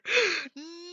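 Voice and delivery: sharp high gasp of dismay; a real startled gasp; shocked gasp. Real, not acted. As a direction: gasp as if the worst just happened. Reader shocked by lost feather.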